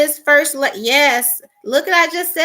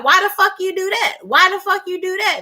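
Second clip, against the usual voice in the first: higher pitched exasperated demand; angry wife; 6 semitones higher than usual. Retold or imitated. Wife confronting her husband after the visit, high and exasperated. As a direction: hurl the question at him, pitched up.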